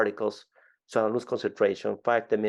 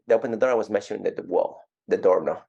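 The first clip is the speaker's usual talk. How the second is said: ordinary delivery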